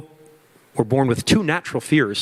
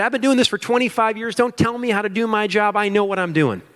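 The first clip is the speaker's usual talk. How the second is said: higher, mocking a stubborn old-timer; a hint of huffy boss, pitched high; mock know-it-all; 9 semitones higher than usual. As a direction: send up the owner who won't take advice. Mocking stubborn business owners.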